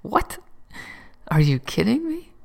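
stunned friend, pitch leaping up; retold disbelief. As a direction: replay the shock of hearing your atheist friend. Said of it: "I said".

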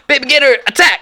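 high, loud yelled command; acted battle cry. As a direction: yell it like launching an attack. Acted battle cry while throwing gator.

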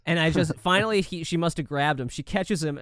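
ordinary talk

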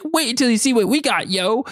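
high, put-on slangy Toad. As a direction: pitch it way up like a gleeful little sidekick. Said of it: "He's like".

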